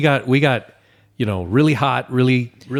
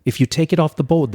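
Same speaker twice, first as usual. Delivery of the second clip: higher, insistent imitation of a kid; daughter's coaching; 6 semitones higher, 4 dB louder, faster than usual. Daughter warns Dad while he handles pins, imitated insistent coaching. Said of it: "she's like".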